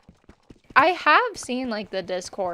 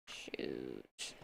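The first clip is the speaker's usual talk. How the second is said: whispered curse; 18 dB quieter than usual. Real, not acted. Game mishap.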